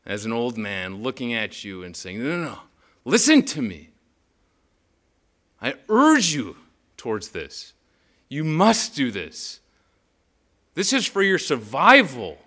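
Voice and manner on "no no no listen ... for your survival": put-on Peter as an old man pleading, higher and louder; urgent Peter. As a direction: grip them by the shoulders and insist. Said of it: "This is Peter sitting you down, grabbing you by the shoulders".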